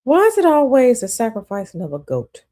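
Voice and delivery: loud, high disbelieving question; incredulous. Real, not acted. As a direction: scoff at the claim, loudly. Mocking goat sacrifice claim.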